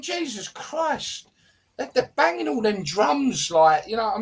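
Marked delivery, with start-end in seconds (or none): 0.0-1.3 exasperated. Real, not acted. Exasperated at paid fans.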